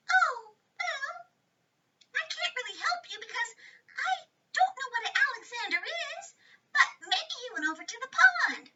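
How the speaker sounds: very high, fluttery butterfly voice. A narrator's reading. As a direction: make the butterfly tiny and high. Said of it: "the butterfly said".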